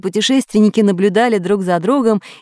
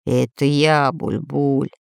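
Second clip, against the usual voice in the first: mope it out; pirate answering sadly from the dark; 6 semitones lower, slower than usual. A narrator's reading. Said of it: "грустный голос в темноте".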